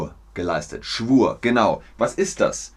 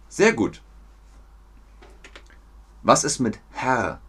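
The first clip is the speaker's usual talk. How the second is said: ordinary delivery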